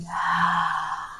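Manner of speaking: long, soft sigh; audible exhale. Shown as a demonstration. Guide pauses in gratitude for mobility, soft sigh. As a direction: sigh out gently. Breathing out after gratitude cue.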